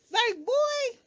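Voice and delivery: put-on high, drawn-out "boy" in disbelief; a sky-high fed-up "boy"; exasperated. Exasperated at cast member.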